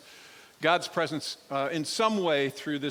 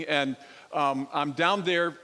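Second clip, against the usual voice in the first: usual speaking voice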